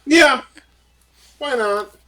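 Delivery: ordinary talk, nothing marked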